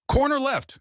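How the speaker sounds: sharp high bark of a warning; barked call. Acted. Samir barks a warning to Jackson mid-raid, sharp high call. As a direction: bark the corner call to your partner. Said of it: "Samir barked".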